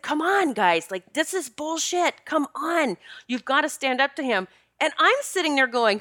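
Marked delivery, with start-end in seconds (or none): 0.0-4.5 retold high-pitched plea to act; exasperated urging. Partner telling coworkers to stand up, exasperated urging. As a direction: imitate a fed-up rallying of the guys.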